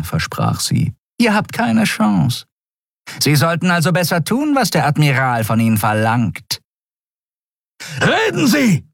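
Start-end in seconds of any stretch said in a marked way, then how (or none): 1.2-6.6 a much higher voice, grinning menace; lightly voiced alien guard, sneering; Skria woman. Shiar grinning as she threatens the prisoner. 8.0-8.8 sharp high bellow; roar. Acted. Telios roaring at the prisoner to talk. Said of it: "brüllte Telios".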